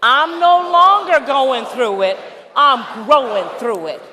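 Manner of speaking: rousing shouted affirmation; full-voice call and response. Shown as a demonstration. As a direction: declare it with the whole room. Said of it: "All right, let's do it".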